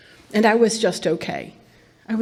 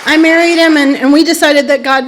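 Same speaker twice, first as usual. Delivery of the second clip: loud; 4 semitones higher, 9 dB louder than usual. Real, not acted. Repeats it over the laughter.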